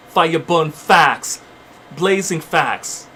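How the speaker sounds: slow, weighty words set down one at a time; slow preacher emphasis; slow emphatic declaration. Real, not acted. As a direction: drop each word like a verdict. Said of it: "fire preaching".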